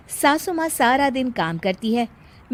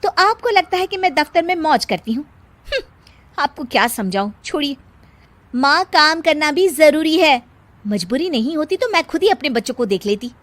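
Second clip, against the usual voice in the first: indignant daughter-in-law; 5 semitones higher, 5 dB louder than usual. Acted. Daughter-in-law arguing back.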